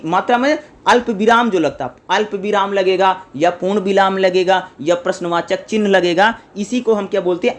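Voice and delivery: normal talking voice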